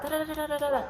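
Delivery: high, rapid-fire string of babble; mock complaining chatter. Imitating customers who kick up a fuss, mock complaining. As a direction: imitate a fussing customer in sing-song da-da-das. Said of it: "go up to Sally's and be like".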